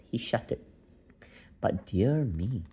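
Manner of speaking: ordinary delivery, nothing marked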